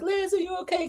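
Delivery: mock concern. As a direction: coo the question soothingly. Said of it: "Joellen was trying to, like".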